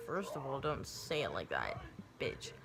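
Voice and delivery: very low, soft comeback; muttered retort. Retold or imitated. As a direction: mutter it under your breath at the rude nurse. Acted aside back at rude nurse.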